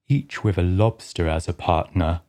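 low, gruff creature voice; a rumbling Griffin. Acted. Griffin starts explaining the dance, low and gruff.

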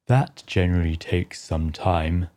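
low, gruff creature voice; a rumbling Griffin. Acted. Griffin starts explaining the dance, low and gruff. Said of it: "interrupted the Griffin".

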